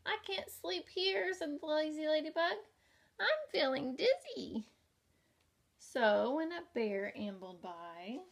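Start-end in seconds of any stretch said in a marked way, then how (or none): 0.1-1.3 high little-bug voice; the ladybug fussing on the crocodile's tail, high pitched; squeaky ladybug. A narrator's reading. Ladybug character voice. 3.2-4.7 high little-bug voice; the ladybug fussing on the crocodile's tail, high pitched; squeaky ladybug. A narrator's reading. Ladybug character voice.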